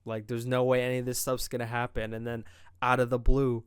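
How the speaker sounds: ordinary talk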